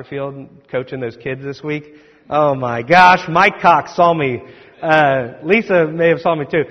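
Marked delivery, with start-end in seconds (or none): none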